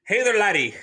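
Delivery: hint of a cheery Irish lilt; Irish accent. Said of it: "sneak peek of the next one".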